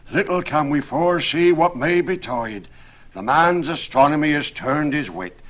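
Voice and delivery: agitated, self-righteous tradesman; alarmed carpenter. Acted. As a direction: cry out piously, then give urgent orders. Alarmed carpenter holds forth.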